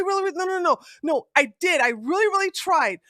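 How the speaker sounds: normal talking voice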